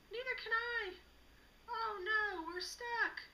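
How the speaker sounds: high, crying voice of a stuck animal; panicked wail. Acted. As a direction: whimper in alarm. Sheep stuck in fence.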